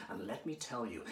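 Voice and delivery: normal talking voice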